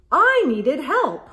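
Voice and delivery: put-on lively read-aloud for kids; animated storybook reading; story narration. A narrator's reading. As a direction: read it like a picture book.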